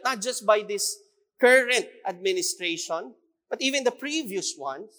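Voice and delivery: usual speaking voice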